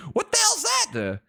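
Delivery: high shocked exclamation; startled what. Real, not acted. Streamer startled by a raccoon on screen. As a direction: jump at the sight.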